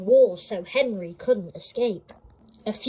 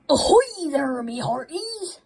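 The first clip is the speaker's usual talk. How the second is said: salty sailor voice; 6 semitones higher than usual. A narrator's reading. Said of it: "said Salty".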